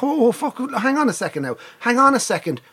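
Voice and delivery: retold alarm, pitched right up; mock panicked pleading. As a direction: plead with a gunman, high and urgent.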